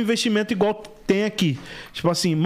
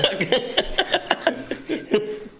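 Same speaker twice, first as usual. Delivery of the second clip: breathless laugh; 6 semitones higher than usual. Real, not acted.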